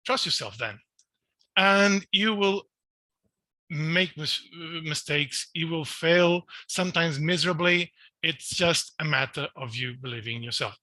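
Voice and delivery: ordinary delivery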